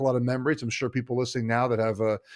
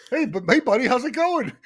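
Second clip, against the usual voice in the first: bright, much higher singsong greeting; mock cheery text; 12 semitones higher, 4 dB louder than usual. Retelling to a friend how she texted an oblivious buddy mid-labor, high chirpy mock greeting. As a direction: play it falsely casual and chirpy. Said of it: "I was like".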